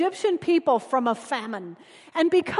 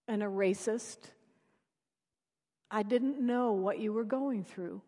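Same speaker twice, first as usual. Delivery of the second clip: soft tender reply to a crying stranger; tender apology; 4 semitones lower, 9 dB quieter than usual. Retold or imitated. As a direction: console her quietly. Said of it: "my friend said".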